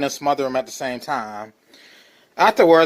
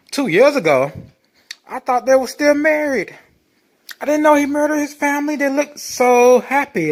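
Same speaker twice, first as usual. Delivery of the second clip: mock disbelief; 9 semitones higher, 3 dB louder than usual. Sarcastic mock disbelief at photos.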